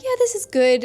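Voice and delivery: high, mock-casual "yeah, this is good"; lukewarm approval. Recalling her so-so first read, in a mild mock-lukewarm voice. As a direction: imitate her past shrug of a reaction. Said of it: "I was kinda like".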